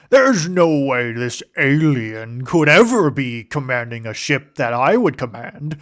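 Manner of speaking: slow, drawn-out put-on accent, mocking a core-world Imperial. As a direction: sneer it slowly in a foreign accent. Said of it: "I don't know why I went with that accent specifically".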